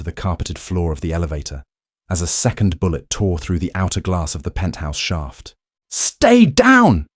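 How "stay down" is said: loud, much higher shouted command; roared order. Acted. Boss shielding the maid from bullets, roaring at her. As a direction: bellow it over the gunfire. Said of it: "he roared".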